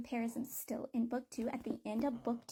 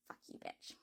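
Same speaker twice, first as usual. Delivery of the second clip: hushed, whispered cursing; whispered insult; 2 semitones lower, 7 dB quieter than usual. Retold or imitated. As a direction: curse him under your breath, barely voiced. Muttered reaction to male lead.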